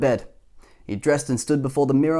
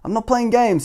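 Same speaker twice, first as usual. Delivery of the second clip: stern; 9 semitones higher, 4 dB louder than usual. A narrator's reading. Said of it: "he said in a stern voice".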